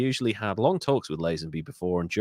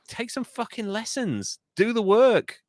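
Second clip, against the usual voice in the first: high-pitched incredulous scolding; exasperated rebuke; 11 semitones higher than usual. Real, not acted. Host scolding untrained actor, exasperated high voice.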